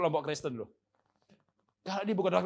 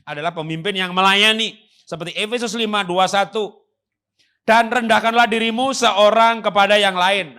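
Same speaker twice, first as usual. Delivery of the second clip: preaching at full force; 6 semitones higher, 15 dB louder than usual. Acted. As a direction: proclaim it to the whole hall. Preacher declaiming scripture.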